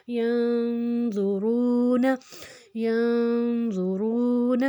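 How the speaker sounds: slow ینظرون with n held as a nasal hum; nasal ghunna. Shown as a demonstration. Teacher demonstrates ghunna to learners, held nasal n in ینظرون. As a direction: hum the n through the nose in ینظرون, heavy z, long "roo". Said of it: "غنہ کر کے اس کو ادا کریں گے".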